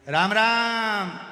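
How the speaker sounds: slow, loud, high greeting called across the ward; loud drawn-out greeting. Acted. Deaf visitor greets the patient far too loudly. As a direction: stretch it out at full volume. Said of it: "वो चिल्ला के बोलता है".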